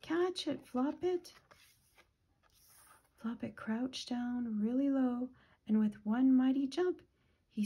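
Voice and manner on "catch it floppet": high-pitched, urgent storybook call; narrated shout. A narrator's reading. Animals shout to Floppet to catch the egg, mock shout. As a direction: cry out high without much more volume. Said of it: "pointed up towards the egg and shouted".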